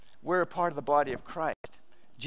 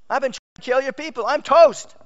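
loud, high-pitched alarm; hammed-up dread, voice shooting up; mock panic; 10 semitones higher, 11 dB louder than usual. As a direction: build to a doomed cry, acting out panic. Paul's panicked thoughts, acted out.

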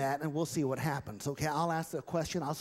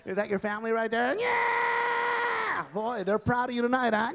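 ventriloquist voice thrown out; puppet voice; 9 semitones higher than usual. Acted. Dummy voice.

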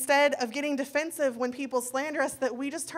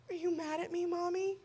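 put-on higher, soft, timid little-girl voice; little girl's voice; 6 semitones higher, 7 dB quieter than usual. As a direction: play the worried small daughter asking softly. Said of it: "she turns to me and she says".

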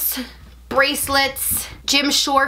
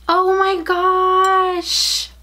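sharp gasp, then a very slow, high oh my gosh; gasp of delight; 7 semitones higher, much slower than usual. Real, not acted. Seeing the mug, she gasps in delighted surprise. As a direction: catch your breath at the surprise. First item revealed.

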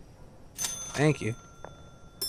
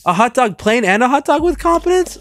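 much higher, louder outburst; loud exclaiming; 9 semitones higher, 7 dB louder than usual. Real, not acted. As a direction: shout it out in disbelief. Exclaiming at order.

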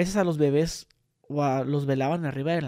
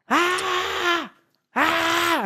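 high, sustained scream, held back in volume; grito; 11 semitones higher than usual. Shown as a demonstration. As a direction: demonstrate a long high-pitched "aah" yell. (grito agudo y sostenido, de demostración)

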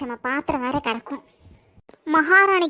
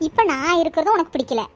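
ordinary talk, nothing marked

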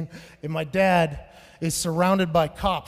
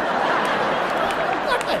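mom voice; 12 semitones higher than usual. Retold or imitated. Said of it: "she'll go".

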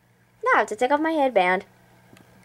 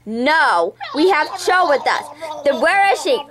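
ordinary talk, nothing marked

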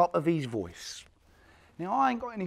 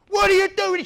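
much higher, louder outraged shout; 14 dB louder than usual. Retold or imitated. Boys screaming at the guard who kicked the kid, shout. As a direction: scream it in protest. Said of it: "we've screamed blue murder".

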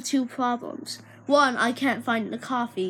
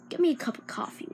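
ordinary delivery

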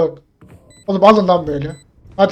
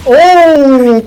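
long high shouted "oo", gliding down; loud impressed "oo"; 6 semitones higher, 9 dB louder than usual. Real, not acted. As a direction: cry out at a great play. Cheering a good play. (hayranlıkla bağırarak uzun "oo")

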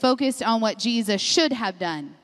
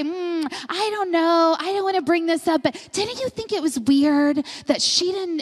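gossipy aside; 5 semitones higher than usual. Retold or imitated. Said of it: "we say".

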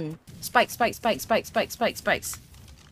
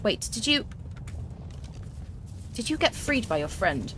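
normal talking voice

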